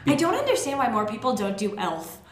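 normal talking voice

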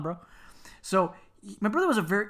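normal talking voice

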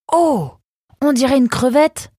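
high, loud gasp of discovery; astonished. A narrator's reading. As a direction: exclaim in amazement, voice leaping way up. Nemrod discovers the fossil.

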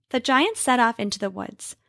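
usual speaking voice

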